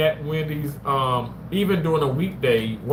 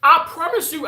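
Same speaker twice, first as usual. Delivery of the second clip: stunned disbelief; 13 semitones higher, 6 dB louder than usual. Real, not acted. Discontinued snack news.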